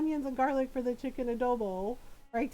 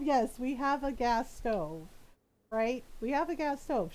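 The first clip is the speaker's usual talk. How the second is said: ordinary talk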